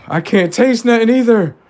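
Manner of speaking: high and loud, panicked; panicked squeal. Retold or imitated. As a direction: squeak it out in alarm. Acted panic over lost taste.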